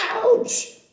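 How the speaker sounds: high, loud yelp of pain; startled ouch. Acted. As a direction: yelp as if pinched. Doctor pinches Papa's waist.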